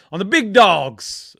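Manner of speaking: drawn-out, loud hype on big dogs; Grizz's catchphrase. Retold or imitated. Quoting his mate's catchphrase as a drawn-out loud hype call. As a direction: bellow the big dogs slowly like a hype man. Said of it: "as Grizz likes to say".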